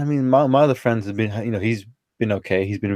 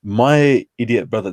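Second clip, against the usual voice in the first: ordinary talk, nothing marked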